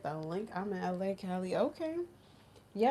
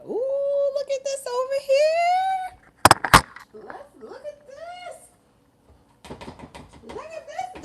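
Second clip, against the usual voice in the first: squeaky high voice; 8 dB louder than usual. Pretend. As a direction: squeak it like a cartoon critter. Squeaky voice showing something.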